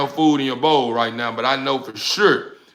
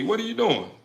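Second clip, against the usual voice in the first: ordinary talk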